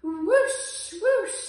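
high, loud whoosh; whooshing whale. Acted. Gigantic whale spouting its boast, high and loud. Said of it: "said the gigantic whale".

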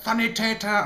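German word. Shown as a demonstration. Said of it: "occasionally random words like".

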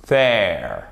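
THERE with a voiced TH, tongue between the teeth; th anglais correct. Shown as a demonstration. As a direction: say THERE with a buzzing English TH. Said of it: "elle va ripper sur les dents du haut pour sortir". (« there » avec le th anglais, langue entre les dents)

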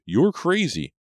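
high-pitched loud outcry; panicked, screamed accusation. A narrator's reading. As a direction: cry it out frantically. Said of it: "Brady screamed".

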